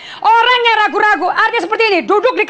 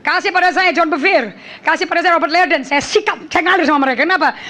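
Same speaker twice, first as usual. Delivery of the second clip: ordinary talk, nothing marked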